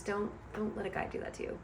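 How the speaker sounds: a quick, hushed warning to the ladies; whispered advice. Real, not acted. Reviewer whispers a quick warning to female viewers. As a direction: whisper it fast like a secret.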